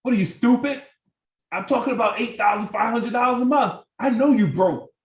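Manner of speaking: condescending put-down to a friend who said no; rude, mocking scorn. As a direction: sneer at them like they're stupid. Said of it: "Don't, don't say".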